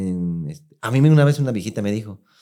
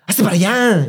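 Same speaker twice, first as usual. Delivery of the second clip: high mock old woman shooing; cranky grandma telling him to move; grumpy old lady; 9 semitones higher, 4 dB louder than usual. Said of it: "Esos viejitos enojados".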